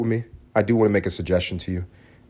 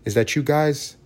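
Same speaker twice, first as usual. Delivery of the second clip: ordinary talk, nothing marked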